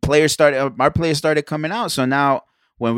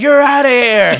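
much higher and louder, a barked warning; referee shout; 10 semitones higher, 8 dB louder, slower than usual. Retold or imitated. Retelling the ref warning the player, loud mock shout. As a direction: shout it like a ref throwing someone out.

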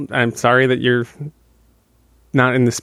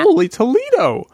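surprised; 12 semitones higher than usual. Acted. Mock amazement at fast talking.

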